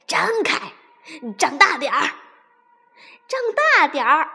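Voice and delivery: doctor yelling at the fox to open wide; shouting. A narrator's reading. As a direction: yell it in alarm, building to the last. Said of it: "低搜头医生赶紧大叫".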